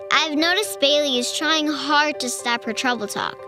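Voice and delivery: ordinary delivery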